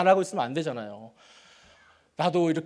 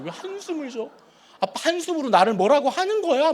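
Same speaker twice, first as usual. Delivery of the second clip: higher whiny daughter's mock wail; imitating crying daughter; 9 semitones higher, 4 dB louder than usual. Father imitating his crying daughter, higher tearful whine. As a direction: play the tearful daughter hurt by the sigh. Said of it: "울면서".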